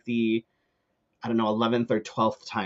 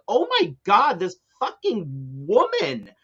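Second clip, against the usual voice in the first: exasperated; 6 semitones higher than usual. Retold or imitated. Fed up with the woman cackling behind me, exasperated. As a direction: pitch it way up, at the end of your patience.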